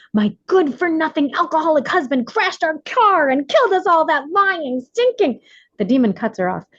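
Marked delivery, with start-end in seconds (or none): 0.1-5.4 much higher, shrill-pitched angry outburst at her husband; a furious wife ranting, cut off mid-insult; shouting wife. Acted. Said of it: "The lady starts shouting".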